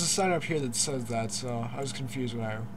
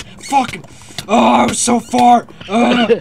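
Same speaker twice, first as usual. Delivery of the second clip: loud high startled yell; yelp, then drag it out; 9 semitones higher, 16 dB louder, slower than usual. Real, not acted. Nearly dies mid-story.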